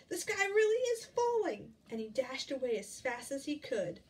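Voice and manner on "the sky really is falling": high, loud panicked cry. Acted. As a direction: shout in sheer panic. Fox panics, sky falling.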